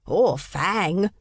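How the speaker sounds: put-on boy putting on an English accent; a touch of mock English accent. A narrator's reading. Twin joking at his mother's expense, English accent. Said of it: "said in an English accent".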